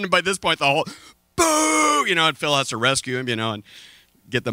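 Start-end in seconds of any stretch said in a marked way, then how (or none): 1.3-2.1 crowd booing. Retold or imitated.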